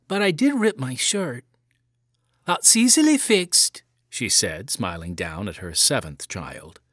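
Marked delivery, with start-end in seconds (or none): 0.1-1.4 lightly voiced small boy, higher; a hint of a five-year-old owning up; little boy confessing to his mother; young boy. A narrator's reading. 2.5-3.8 lightly voiced mother, much higher; a hint of a fond Irish mother; mother reassuring her son about the shirt; cheerful mother. A narrator's reading.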